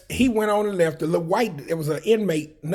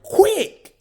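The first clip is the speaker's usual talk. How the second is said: calling out; 7 dB louder than usual. Retold or imitated. Friend calling out nickname.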